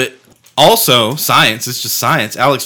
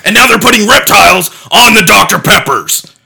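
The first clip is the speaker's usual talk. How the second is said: high, loud ranting conspiracy voice; conspiracy theorist; 7 semitones higher, 7 dB louder than usual. Pretend. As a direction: rant it like a paranoid radio host. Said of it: "are you Alex Jones".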